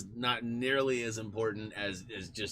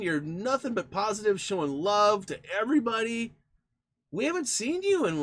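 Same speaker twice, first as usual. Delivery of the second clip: higher and louder, urgent concern; concerned call-out; 13 semitones higher, 7 dB louder than usual. Real, not acted. Streamer calls out to absent patron Andrew, higher and louder with concern. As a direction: call out to a missing friend.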